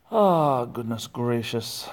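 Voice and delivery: ordinary talk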